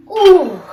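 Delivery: loud relieved oh; relieved gasp. Acted. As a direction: let out a big oh of relief. Tin Man freed, gasp.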